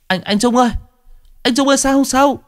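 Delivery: terrified pallbearer calling to the son; panicked cry. Acted. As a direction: scream for help in terror.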